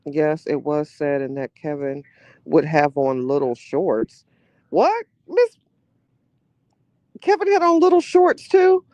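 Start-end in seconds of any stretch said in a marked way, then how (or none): 4.7-8.8 much higher, louder incredulous squeal; high-pitched disbelief. Real, not acted. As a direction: shriek it in disbelief. Disbelief at Kevin's shorts.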